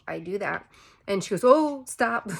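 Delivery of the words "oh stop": sudden loud, high "stop"; urgent nurse calling a halt; nurse's sharp stop. Retold or imitated. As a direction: cut her off fast and loud. Nurse urgently stopping the push.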